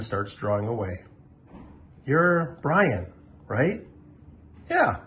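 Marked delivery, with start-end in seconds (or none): 2.1-5.0 lightly voiced higher boy; greeting a shy classmate; friendly kid. Justin meets Brian.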